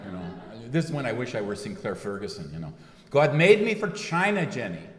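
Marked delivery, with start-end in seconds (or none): 3.1-4.9 a poor Scottish brogue, higher and louder on God made me; half-hearted Scottish brogue. Retold or imitated. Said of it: "It really goes poorly".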